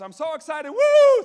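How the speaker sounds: building to a high, loud whoop; over-the-top excited cheer; mock excitement. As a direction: act out three exclamation points. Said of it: "three exclamation points".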